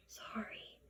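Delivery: breathy hushed whisper. A narrator's reading. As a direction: whisper it so no one wakes.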